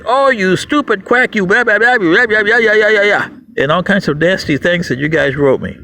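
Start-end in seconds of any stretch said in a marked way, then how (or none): 0.0-3.3 mocking critics. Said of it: "a whole bunch of emails saying".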